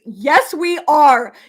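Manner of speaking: loud, drawn-out affirmation; emphatic cheer. Real, not acted. Vlogger answering ladies in her chat, emphatic cheer. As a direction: cheer it out slowly and loudly.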